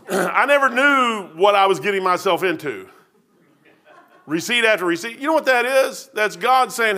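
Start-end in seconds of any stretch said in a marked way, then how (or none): none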